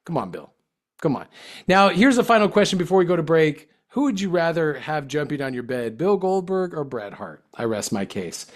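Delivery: normal talking voice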